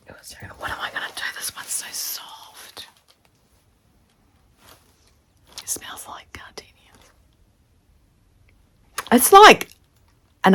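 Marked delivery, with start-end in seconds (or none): 0.1-6.9 hushed, breathless whisper of wonder; awed whisper. Real, not acted. Vlogger unwrapping a friend's fabric gift, whispering in awe. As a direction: whisper it like you can't believe it.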